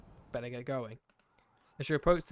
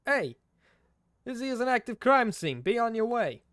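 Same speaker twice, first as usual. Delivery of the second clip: loud, much higher Brooklyn yell; Brooklyn cop yell; 9 semitones higher, 8 dB louder than usual. Acted. Brooklyn cop yelling at a bystander to move along, loud. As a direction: bark it like a Brooklyn beat cop shooing you off. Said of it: "yells in a thick Brooklyn accent".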